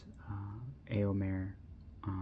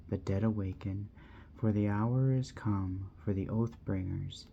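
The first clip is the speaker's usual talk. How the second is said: measured, ringing verse reading with swells on key lines; poem recitation; 4 dB louder than usual. Acted. Vlogger reading the seer's prophecy to viewers, solemn recitation. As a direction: declaim the prophecy like an ancient chant.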